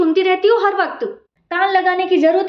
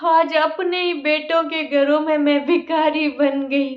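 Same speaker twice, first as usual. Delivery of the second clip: slow despairing lament; slower than usual. Acted. Old mother lamenting her fate to God as they quarrel. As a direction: mourn it slowly, crushed.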